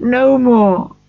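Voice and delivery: high, loud desperate cry; a loud high wail of refusal; cried out. A narrator's reading. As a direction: refuse it with anguish. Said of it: "cried Scrooge".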